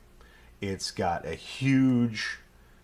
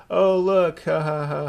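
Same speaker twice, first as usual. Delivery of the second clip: high, loud fake excitement; mock delight; 8 semitones higher, 9 dB louder, slower than usual. Streamer spots a following bot, mock-delighted outburst. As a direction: put on a big staged "ha, ha, ha".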